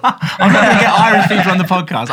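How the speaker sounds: laughing through the words. Real, not acted. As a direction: say it while cracking up. Repeats line, laughing louder.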